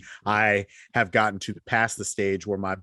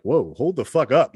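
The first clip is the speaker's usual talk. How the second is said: higher, louder startled parent; startled mock outburst; 5 semitones higher, 6 dB louder than usual. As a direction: pull up short at a kid cussing, half laughing. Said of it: "And you're like".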